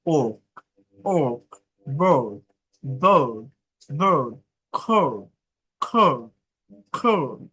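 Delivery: "ওক" and "বার্ড" with long vowel, no r; silent R. Shown as a demonstration. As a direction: drop the r before the consonant in "বার্ড". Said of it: "আর এর উচ্চারণ হবে না".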